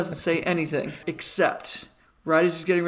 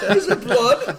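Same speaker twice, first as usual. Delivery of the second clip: higher, laughing Cockney question; mock Cockney; 7 semitones higher, 5 dB louder, slower than usual. Joining the Cockney game, higher and laughing. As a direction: join in the Cockney bit. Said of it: "Cockney accent".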